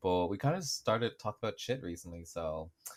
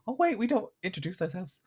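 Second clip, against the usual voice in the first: pitched way up, a mock sudden realization; 12 semitones higher than usual. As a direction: act out a startled "oh wait" moment. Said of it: "I was like".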